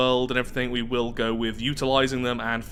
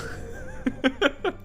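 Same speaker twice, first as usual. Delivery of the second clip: high, soft chuckle; amused laugh; 12 semitones higher, 4 dB quieter than usual. Real, not acted. Player tickled by the funny description, amused laugh. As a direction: laugh lightly at something silly.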